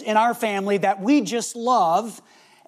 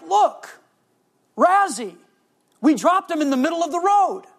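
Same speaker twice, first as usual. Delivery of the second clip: put-on kids panicking as their toy raccoon lies in the road; panicky kids; 8 semitones higher than usual. Kids panicking over dropped toy.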